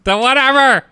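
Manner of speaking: high-pitched put-on fan complaint; whining listener. Listener griping about the web address, high mock whine. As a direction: squeal it like an exasperated fan. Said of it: "Why do our listeners sound like this?".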